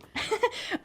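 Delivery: sudden high giggle; high burst of laughter. Real, not acted. Narrator laughing at herself for tearing up, high giggle. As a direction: break into a squeaky laugh. Laughing at her own reaction.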